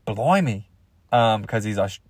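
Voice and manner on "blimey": low mock-British exclamation; a light, put-on British reaction; Ricky Gervais. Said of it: "Ricky Gervais was watching that smack and being like".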